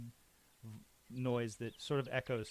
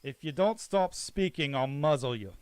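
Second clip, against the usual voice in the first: much louder warning; loud threat to the mouthy prisoner; guard threatening; 2 semitones higher, 10 dB louder than usual. Acted. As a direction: threaten to muzzle him.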